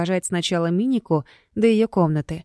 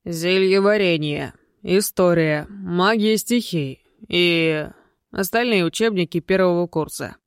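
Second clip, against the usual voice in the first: booming voice. Acted. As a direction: rattle off the list in a booming voice. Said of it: "перечислил зычным голосом". (зычным голосом, неторопливо)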